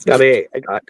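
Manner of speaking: ordinary talk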